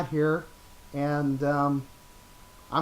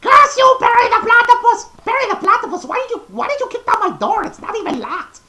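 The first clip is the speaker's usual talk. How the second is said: much higher, much louder cartoon villain rant; Doctor Doofenshmirtz; 12 dB louder than usual. Villain shouting at his platypus nemesis, loud rant. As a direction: shriek at your nemesis in outrage. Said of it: "Doctor Doofenshmirtz from Phineas and Ferb".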